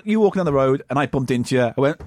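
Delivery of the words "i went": ordinary talk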